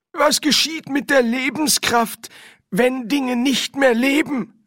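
high, loud put-on character voice; inquiring Windel. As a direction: press the spirit with a high-voiced question.

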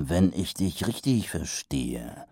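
slow, measured and doubtful; slowly. Acted. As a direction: spell it out slowly, unconvinced. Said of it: "sagte Mumm langsam".

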